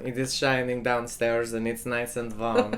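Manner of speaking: stiff put-on accent, meant Brazilian but German; host a show in a bad mock-German accent; botched Brazilian accent gone German; German-sounding Brazilian accent. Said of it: "my Brazilian guy is German".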